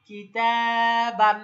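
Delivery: loud, very slow "কিতাবান" with a long "তা"; stretched demonstration. Shown as a demonstration. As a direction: sustain the "তা" in "কিতাবান" on a level pitch. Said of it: "তাহলে আমরা কি বলবো?".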